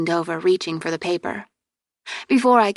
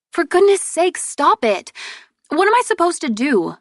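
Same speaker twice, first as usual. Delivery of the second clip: exasperated scolding; 10 semitones higher, 4 dB louder than usual. Acted. Exasperated sister scolds laughing brother.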